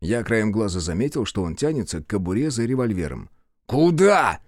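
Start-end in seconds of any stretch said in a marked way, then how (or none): 3.6-4.4 loud, high challenge; raised voice. A narrator's reading. Man catching a policeman reaching for his gun, voice raised. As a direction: raise your voice to stop him dead. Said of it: "снова повысил голос я".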